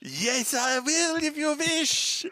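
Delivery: put-on very high, slow French accent. Host agrees to keep the voice, very high French accent. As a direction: draw it out in high mock French. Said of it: "hurts my throat to keep doing that".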